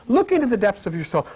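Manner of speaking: ordinary talk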